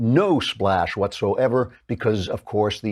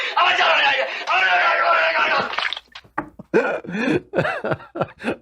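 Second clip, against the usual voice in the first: real laughter. Real, not acted. Laughing at own bit.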